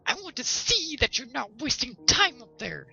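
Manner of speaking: high, loud suspicious wife voice; nagging wife. A narrator's reading. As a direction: keep a sharp eye on him, nagging. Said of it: "said the wife".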